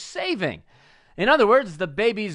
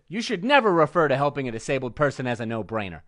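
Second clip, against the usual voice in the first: usual speaking voice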